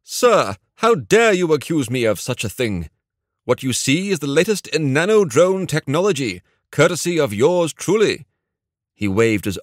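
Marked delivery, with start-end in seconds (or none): 0.0-8.3 put-on high, theatrical English accent, playfully affronted; mock-indignant English accent. A narrator's reading. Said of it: "mock indignation and continued in his English accent".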